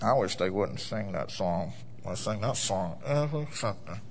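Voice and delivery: low grumbling mock churchgoer; murmuring complainer. Pastor mimics a church member murmuring about songs, low and grumbling. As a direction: grumble under your breath like a sour member. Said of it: "Y'all ever heard anybody do that before?".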